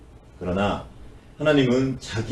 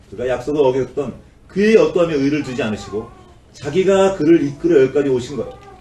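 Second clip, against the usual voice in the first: normal talking voice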